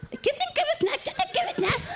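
high nagging mock voice; high wheedling imitation of Iki; mimicking Iki. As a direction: pitch it up, pester them.

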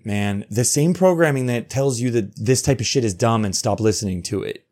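normal talking voice

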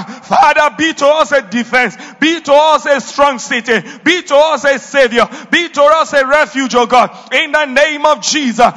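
loud, rising preacher's prayer; fervent prayer. Real, not acted. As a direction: pray it out with full force.

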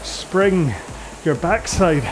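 ordinary talk

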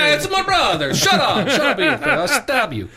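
high-pitched hammed-up gangster threats; mock Italian tough guy. Co-host riffing on an Italian dealer name, mock tough guy. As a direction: play a cartoonish Italian wise guy picking a fight.

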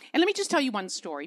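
usual speaking voice

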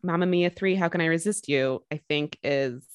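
normal talking voice

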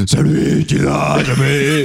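slow, drawn-out singer impression; [name] voice. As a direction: drag it out like a worn-out old singer. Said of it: "ça c'est [name]".

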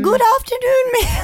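very high, loud silly voice; absurdly high-pitched prank-call voice; put-on phone voice. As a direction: squeak out a mock caller's greeting. Auditioning another silly phone voice.

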